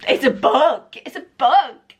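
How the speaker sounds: high, loud delighted repeat; excited. Real, not acted. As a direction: let the joy rise. Holding her book.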